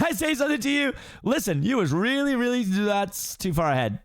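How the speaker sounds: high chatty donkey voice, cut off; excited Donkey. Acted. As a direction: gush at the ogre, then stop short. First take, skipped ahead.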